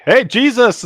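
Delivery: high, loud mock call-out; playful shouted hail; shout into the air. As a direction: holler a name as if hailing someone. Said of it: "So you just shout into the air".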